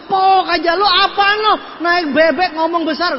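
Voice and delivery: high, loud mocking jab; mock heckler's jeer. Heckler mocking the moped-riding speaker, loud high jeer. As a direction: jeer loudly at a big talker.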